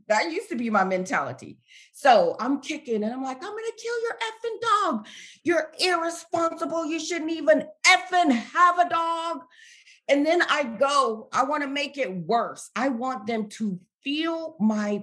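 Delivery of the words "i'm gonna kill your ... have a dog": very high-pitched ranting, re-enacted; angry retold cursing. Re-enacting the tirade at the dog's owners, high-pitched angry rant. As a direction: hurl accusations in a high, strained voice. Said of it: "I cursed them out".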